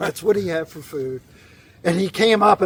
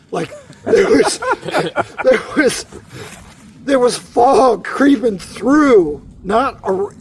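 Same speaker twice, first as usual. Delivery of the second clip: laughing; 7 semitones higher, 5 dB louder, much slower than usual. Real, not acted. Laughing while describing spooky cemetery.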